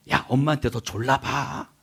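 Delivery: ordinary delivery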